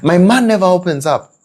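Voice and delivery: high, emphatic complaint; complaining wife. Retold or imitated. Woman in Kisumu complaining about her man, high. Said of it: "did you get that".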